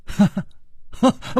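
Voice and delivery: short acted ha-ha laughs; laughing. A listener reacts to the calm explanation, laughing. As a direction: laugh in two high bursts. Narrator acts out a character's laugh.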